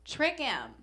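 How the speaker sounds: mock magazine voice. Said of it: "It's always like".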